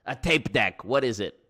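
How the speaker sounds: loud mock Brooklyn question; puzzled wiseguy, punched; New York accent.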